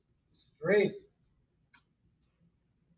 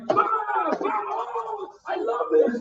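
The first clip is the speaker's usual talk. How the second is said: mock gleeful shout, much higher; gleeful fighter; 12 semitones higher, 4 dB louder, much slower than usual. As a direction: ham up a fighter loving the brawl. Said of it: "I'm like".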